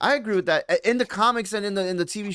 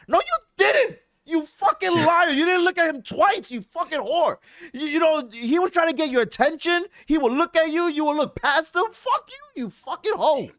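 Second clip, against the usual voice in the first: higher pitched, worked-up tirade at a fictional character; mock outraged rant; 11 semitones higher than usual. Gamer yelling at Mary Jane for kissing Peter at the funeral, high-pitched outrage. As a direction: tear into her like she can hear you.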